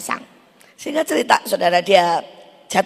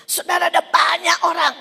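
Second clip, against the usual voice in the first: preaching shout; 9 semitones higher than usual. Real, not acted. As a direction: thunder it at the congregation.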